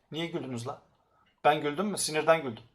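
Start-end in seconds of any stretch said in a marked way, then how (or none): none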